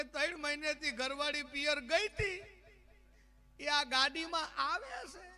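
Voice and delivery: high-pitched comic sob story; mock weeping. Man on the bench explains why he cries, mock weeping. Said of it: "બીજો રોતો હતો".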